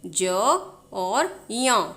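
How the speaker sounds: ज and या stretched slowly apart; slow spelling out. Shown as a demonstration. Teacher slowly splits ज्या into its letters. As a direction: break the letter into its parts slowly. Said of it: "दो अक्षरों को मिलाकर".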